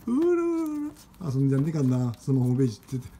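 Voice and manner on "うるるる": high rolled cooing trill; pigeon coo. Retold or imitated. Man cooing at his pet pigeon, high rolled trill.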